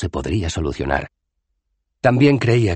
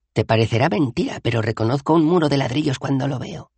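higher-pitched, mildly wounded retort; reproachful; 10 semitones higher than usual. A narrator's reading. Dos Flores answers a doubting Bethan, mildly reproachful. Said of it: "le reprochó Dos Flores".

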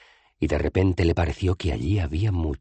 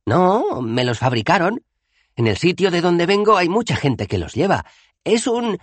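explica, mucho más agudo; Dos Flores explicando; 4 dB louder than usual. Acted. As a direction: Dos Flores da su charla sobre prótesis. (Dos Flores didáctico, muy agudo)

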